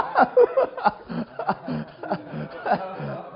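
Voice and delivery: high, loud burst of laughter; real laughter at the memory. Real, not acted. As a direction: laugh out at how crazy it was.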